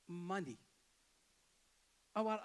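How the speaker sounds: normal talking voice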